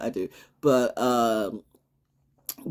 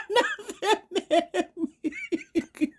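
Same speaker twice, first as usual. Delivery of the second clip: squealing laugh around the words; high giggling; much slower than usual. Real, not acted. Laughing off the haters, high squealing giggle.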